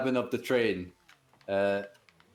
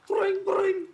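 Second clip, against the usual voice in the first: mock phone ring; 3 dB louder than usual. Imitating a phone ringing.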